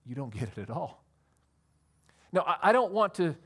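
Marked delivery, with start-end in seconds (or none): 0.0-1.0 quiet Jesus. Retold or imitated. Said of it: "Jesus looks at Pilate and says".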